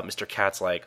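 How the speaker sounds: normal talking voice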